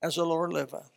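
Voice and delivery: quiet, mild As the Lord liveth; softly. Shown as a demonstration. King saying it meekly, the wrong way. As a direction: say it softly, as the king did not. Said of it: "He didn't say it like".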